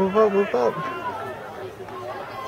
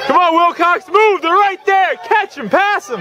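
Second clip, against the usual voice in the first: cheering shout. Real, not acted. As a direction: scream him on from the sidelines. Cheering a runner in race.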